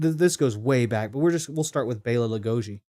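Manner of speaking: ordinary talk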